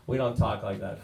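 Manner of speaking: ordinary talk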